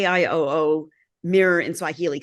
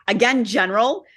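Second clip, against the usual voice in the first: normal talking voice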